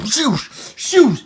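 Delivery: high, rising-in-force whoosh noise; swooping whoosh. Acted. Dragons flying at Buttercup, loud swoosh noises.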